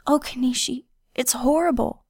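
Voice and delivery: high, tearful cry of distress. A narrator's reading. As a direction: sob it out in anguish. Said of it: "Her voice became a hoarse whisper".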